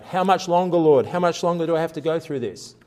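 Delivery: mock the whining prayer of someone fed up; a hammed-up, weary cry of how much longer; impatient complaining prayer. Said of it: "tied up in these prayers about".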